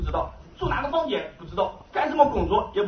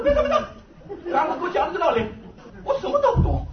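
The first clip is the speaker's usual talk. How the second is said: excited laughing plea; 9 semitones higher than usual. Retold or imitated. Begging the believer to explain everything: high laughing excitement. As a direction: stop him, half laughing, voice up high.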